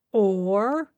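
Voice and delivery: a pointed, knowing echo; understated meaningful repeat; significant. A narrator's reading. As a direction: weigh the word with meaning. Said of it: "repeated his partner significantly".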